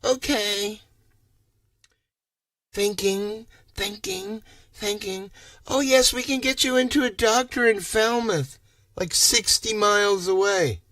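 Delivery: high, sing-song fake-helpful voice; high mock-cheerful clerk, thinking thinking; mocking operator. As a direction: play the call-centre clerk, cheerful and useless. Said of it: "I'm like".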